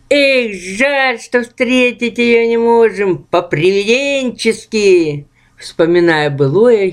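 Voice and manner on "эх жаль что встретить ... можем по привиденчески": sly. Acted. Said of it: "хитро усмехнулся Эх".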